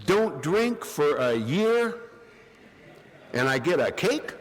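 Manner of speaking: high, slow, stunned repeat; slowly echoing the offer, high and incredulous; disbelief. Retold or imitated. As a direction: spell out the absurd offer in disbelief.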